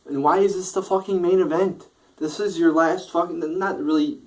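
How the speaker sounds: high, loud exasperated outburst; exasperated rant. Real, not acted. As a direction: vent in disbelief at the booking. Ranting about terrible main event.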